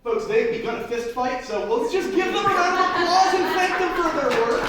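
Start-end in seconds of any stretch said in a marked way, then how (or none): 1.4-4.6 loud, high showman cheer; forced cheerful shout. Acted. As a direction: cover the chaos with cheer. Fleas fight, forced applause.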